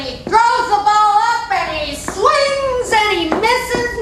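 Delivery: loud, high, stretched-out play-by-play; dramatic storytelling. Acted. Storyteller telling a crowd of the boy's swing, dramatically. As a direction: draw out each action, high and loud.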